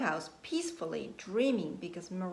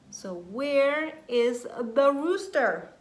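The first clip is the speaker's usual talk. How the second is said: slow and louder, suspenseful; dramatic question; 4 semitones higher, 8 dB louder, slower than usual. Acted. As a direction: build up the mystery.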